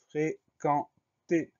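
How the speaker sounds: FRÉQUENTER very slow, split into fré, quen, ter; dictation-style "fréquenter", each syllable drawn apart. Shown as a demonstration. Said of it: "Première syllabe".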